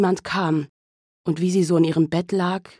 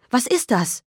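higher, panicked repeat; rising alarm, pitched up; horrified question; 6 semitones higher than usual. Acted. Mother asking the officer again, panicked and higher. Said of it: "fragte entsetzt".

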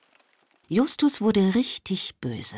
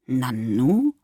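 surprised; 5 semitones lower than usual. A narrator's reading. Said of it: "sagte die Oma überrascht".

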